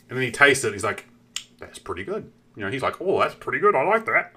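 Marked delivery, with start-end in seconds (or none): 1.6-2.3 soft, lower, understated verdict; quiet approval. Retold or imitated. Scientist tasting his chocolate, quiet understated approval. 3.0-4.3 higher, swooping delighted oddball; eccentric nerd. Acted. Scientist approving his own chocolate, eccentric delighted voice. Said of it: "He was a little eccentric".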